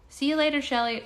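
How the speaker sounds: high, bright goodbye; cheerful greeting. Real, not acted. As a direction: call out a happy goodbye. Greeting viewer by name.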